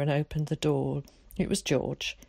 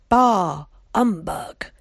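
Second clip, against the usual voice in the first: loud, exasperated Scrooge outburst; cried out; 3 semitones higher, 7 dB louder, much slower than usual. Acted. Boy rehearsing Scrooge paces and cries out loudly. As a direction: bark the words as a fed-up miser. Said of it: "he cried".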